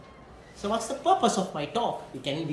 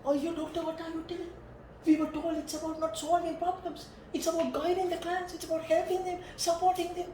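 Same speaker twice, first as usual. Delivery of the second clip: higher, whiny protesting student; mock student protest; 10 semitones higher than usual. Lecturer mimics students objecting to him, higher and whiny. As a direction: imitate students complaining to the doctor.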